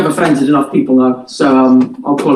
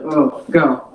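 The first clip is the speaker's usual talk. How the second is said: usual speaking voice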